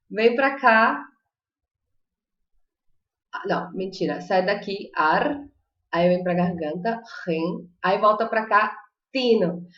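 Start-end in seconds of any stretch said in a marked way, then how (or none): 4.9-9.7 ar, ren, tino broken apart, the ren from the throat; walk through argentino piece by piece; split syllable demo. Shown as a demonstration. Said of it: "Viu que movimento que é".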